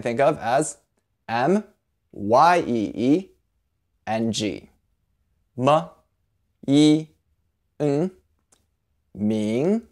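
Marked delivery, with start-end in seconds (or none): 5.6-9.8 M, yi, ng joined into ming, one piece at a time; sound by sound. Shown as a demonstration. Teacher assembling the syllable ming. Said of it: "say it with a rising tone".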